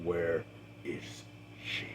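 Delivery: low, hushed, measured question; very calm. A narrator's reading. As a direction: drop to a quiet, controlled near-whisper. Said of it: "Warren very calmly speaks".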